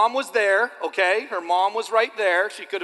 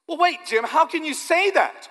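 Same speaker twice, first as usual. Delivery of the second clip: much higher, louder, slow mock objection; objecting listener; 11 semitones higher, 7 dB louder, slower than usual. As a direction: play a doubter protesting. Pastor voices skeptical congregant.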